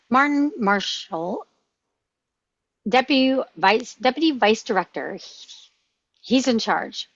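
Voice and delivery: ordinary delivery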